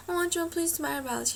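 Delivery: high-pitched, sweet-talking ask; polite request. Retold or imitated. Acting out her request at salon.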